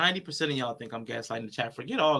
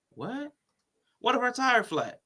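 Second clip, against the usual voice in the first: high, pitched-up disbelief; incredulous; 9 semitones higher, slower than usual. Real, not acted. Streamer arguing with the video, high incredulous. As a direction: raise it as if that's absurd.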